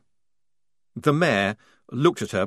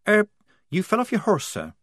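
put-on higher young soldier voice; Polly voice; 11 semitones higher than usual. A narrator's reading. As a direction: voice the young recruit.

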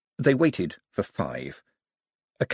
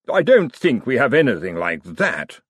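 louder put-on wizard voice; lightly caricatured wizard, raised in volume; 5 dB louder than usual. A narrator's reading. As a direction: fob them off as a stuffy wizard. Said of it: "said the wizard".

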